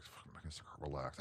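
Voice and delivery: low unvoiced rasp; half-whispered brush-off; mild imitation of an old comic; hushed mock Carlin. Carlin's gruff reply retold.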